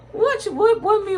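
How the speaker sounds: laughing in disbelief, high then loud; baffled, cracking up mid-question; incredulous laugh. Real, not acted. Answering a viewer's odd church question, laughing in disbelief.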